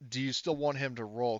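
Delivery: usual speaking voice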